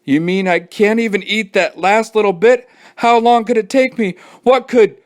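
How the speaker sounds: put-on high, pleading kid voice; whiny kid. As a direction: beg to finish the last bite. Kid whining about dinner.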